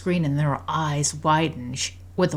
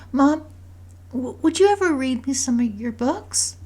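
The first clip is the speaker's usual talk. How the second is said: put-on high, hesitant child's voice; high-pitched kid asking a favour; young daughter; 9 semitones higher than usual. A narrator's reading. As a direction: ask Mom hopefully in a high little voice. Voices daughter asking her mother.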